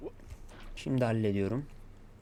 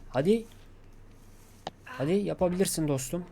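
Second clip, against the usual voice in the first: raised, higher-pitched pep talk; urgent encouragement; 5 semitones higher than usual. Real, not acted. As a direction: urge yourself on, voice lifted. Gamer cheers himself on after failing. (gaza getirerek, yüksek sesle)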